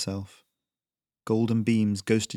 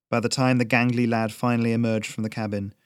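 ordinary delivery

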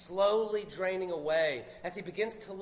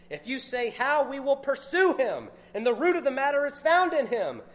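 forceful scripture reading; 3 semitones lower, 5 dB louder than usual. Acted. Preacher reading Job's protest to his friends, at full preaching force.

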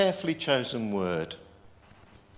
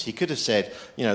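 ordinary talk

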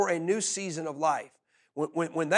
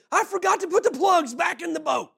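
a touch of high panic; alarmed captain; 9 semitones higher, 4 dB louder than usual. Retold or imitated. Captain confessing to the pastor, mock alarm. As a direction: blurt it out in a high voice. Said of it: "he said".

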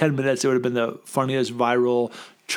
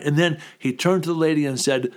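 ordinary delivery

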